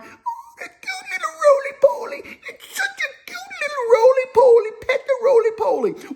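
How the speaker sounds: put-on very high squealing baby talk; squealing kids. Kids crowd around the roly-poly, high squealing coos. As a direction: squeal like excited little kids over a bug. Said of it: "All the kids would come around".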